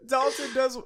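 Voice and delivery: words broken by high laughter; laughing. Real, not acted. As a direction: try to talk through giggles. Cracking up mid-story.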